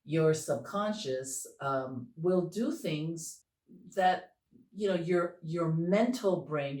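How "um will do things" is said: ordinary talk, nothing marked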